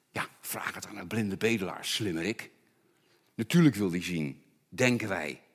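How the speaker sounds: laag, zacht en snel sarcastisch. Real, not acted. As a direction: zeg het met een spottende knipoog. Sarcastische spot. (sarcastisch, laag en zacht)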